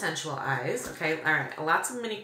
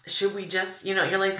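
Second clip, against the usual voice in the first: ordinary delivery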